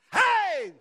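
high loud burst; excited shout. Real, not acted. Preacher whooping at the crowd, loud and high. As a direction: call out to rouse the room. Crowd reacts to resurrection claim.

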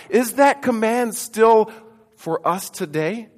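ordinary talk, nothing marked

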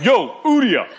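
name split into a loud, high "Yo, Udia" in a joking accent. Shown as a demonstration. Preacher playing with a weird name for the church, joking accent. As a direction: call it out like a street greeting, accent played up. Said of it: "in that accent".

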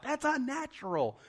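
high-pitched mock alarm; freaked-out reaction. Retelling a reaction to a horror movie, mock freaked-out. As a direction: squeak it as if creeped out.

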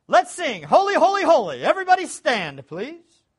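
loud, high worship leader call; calling out to congregation. Retold or imitated. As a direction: announce the hymn brightly and loudly.

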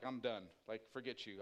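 low, soft grumble to yourself; irritated dismissal. Retold or imitated. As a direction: write them off under your breath. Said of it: "that just irritated me".

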